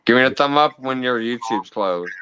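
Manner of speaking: ordinary talk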